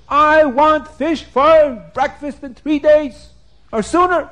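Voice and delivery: hammed-up angry ruler; threatening king. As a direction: lay down an ultimatum to the officer.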